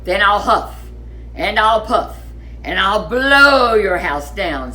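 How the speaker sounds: loud menacing big bad pig voice; pig threatening. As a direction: bellow the threat to blow it down. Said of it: "said the pig".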